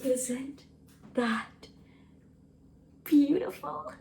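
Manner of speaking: soft, hushed and wondering; almost holding her breath over it; hushed awe. Real, not acted. Opening her first homemade jam, hushed awe.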